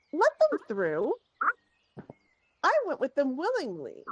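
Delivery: high, insistent command; heated, defiant insistence; indignant demand. Acted. Angry noblewoman confronts guard blocking path.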